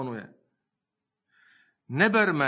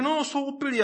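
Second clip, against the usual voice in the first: much higher-pitched mock agreement; mimicking; 10 semitones higher than usual. People taking the scoffers' words seriously, mimicked. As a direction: parody someone taking up the jeer.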